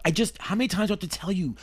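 exasperated caller. Acted. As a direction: rush it out, fed up with repeating. Caller tired of repeating his name.

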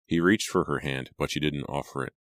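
ordinary delivery, nothing marked

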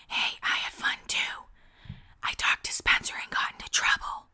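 hushed mock whisper, unvoiced. [name] whispering back to Lauren in class. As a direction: whisper it so the teacher won't hear. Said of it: "whispering about Lauren's birthday".

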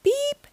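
very high beep; small bright toot; truck horn beep. A narrator's reading. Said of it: "said Blue".

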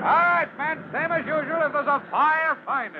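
high, carrying orders to spread out; shouted orders. Acted. Lieutenant shouts orders to his firemen, high carrying call. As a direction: call the orders across the scene.

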